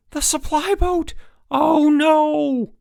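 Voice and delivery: high, loud cry of dismay; panicked scream. A narrator's reading. Counselor screaming as the boat leaves. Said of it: "With a scream of protest".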